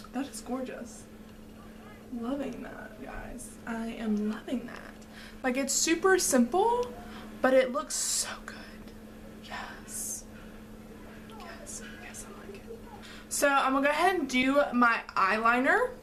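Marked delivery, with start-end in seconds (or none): 0.1-4.9 soft breathy whisper of delight. Real, not acted. Whispered admiration of look. 7.7-12.8 quiet delighted whisper; barely voiced approval. Real, not acted. Whispered admiration continues.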